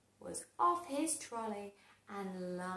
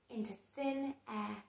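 ordinary delivery, nothing marked

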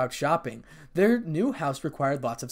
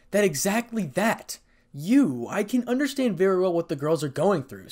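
raised, emphatic exasperation; exasperated; 5 semitones higher, 4 dB louder than usual. A narrator's reading. Kiba setting Issei straight, exasperated. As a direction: spell it out, pitch and volume up. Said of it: "sounding exasperated".

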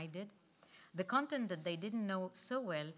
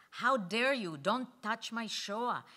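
loud outraged "How dare you?", then "Don't touch my Shoah" slowed right down; offended outburst, slowing at the end; indignant exclamation; 3 semitones higher, 7 dB louder, slower than usual. Acted. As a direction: flare up, then spell out the last words slowly. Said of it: "exclaimed Ludmila".

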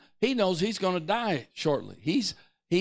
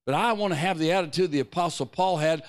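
usual speaking voice

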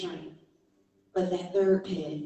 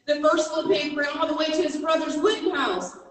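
usual speaking voice

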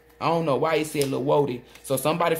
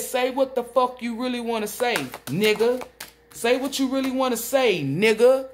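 forceful demand; 6 semitones higher than usual. Acted. Spirit's demand voiced loudly.

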